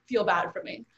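ordinary delivery

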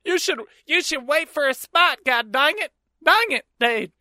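high-pitched hammed-up Southern scold; mock Southern accent. Man mimics woman scolding him, mock Southern accent. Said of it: "Southern accent mouth".

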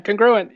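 congruent stressed on GRU, with a reduced first syllable. Shown as a demonstration. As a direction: model congruent as kun-GRU-ent. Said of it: "The stress is on the G".

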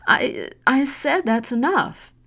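high, slow, shaky plea; tearful protest. Acted. Tearful daughter telling her mother to stop. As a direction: hold back tears while pushing back. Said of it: "tears began to well up".

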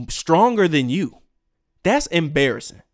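normal talking voice

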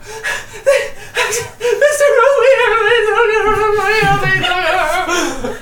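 put-on very high, loud sing-song child's wail, cracking into laughs; frantic child crying for help; wailing small child. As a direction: play a sobbing little kid. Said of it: "a small child comes running".

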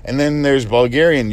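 ordinary delivery

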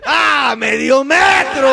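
loud, high drawn-out call; shouted catchphrase. Acted. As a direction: belt the catchphrase out.

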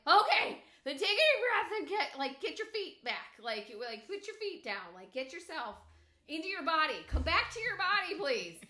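very loud, high urgent instructions; yelling. Retold or imitated. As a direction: shout to bring him back. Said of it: "I ended up having to yell".

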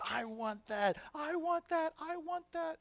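put-on very high, insistent child voice; whining toddler. Toddler pointing at a teddy bear, demanding. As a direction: demand it like a toddler mid-tantrum.

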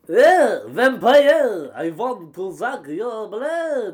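loud, high cry sliding into a slow vampire accent; hammy Eastern European vampire accent. Shown as a demonstration. Showing the storyteller the village woman, in a vampire accent. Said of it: "do you mean like".

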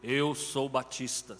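slow, low proud boast; mock proud Baptist. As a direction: thump the chest and say it proudly. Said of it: "Bate no peito".

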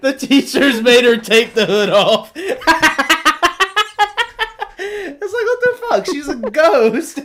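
words squeezed out through high laughter; laughing so hard the pitch jumps up. Real, not acted. Telling friend about daycare costume, laughing through it.

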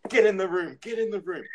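high-pitched mock parental command; ordering parent. Parent waiting to smack kids, mock ordering. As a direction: imitate a parent herding kids along.

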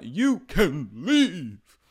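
slow, loud slurred British put-down; drunk British dismissal. Retold or imitated. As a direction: drag it out haughtily, drunk. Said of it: "Meredith gets drunk as hell".

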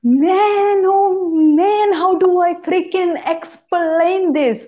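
high, drawn-out exasperated wail; exasperated groaning. Real, not acted. Podcaster struggling to begin a story, exasperated. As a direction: stretch it out high, at a loss.

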